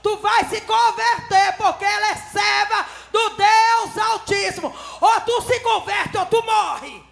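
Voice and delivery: loud, high, slow threat building up; prophetic warning. Retold or imitated. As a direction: thunder out the warning slowly. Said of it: "Eu disse". (advertência profética gritada e lenta)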